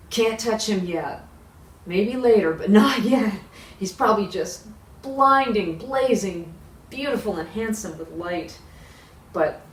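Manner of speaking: ordinary talk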